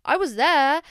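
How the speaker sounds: usual speaking voice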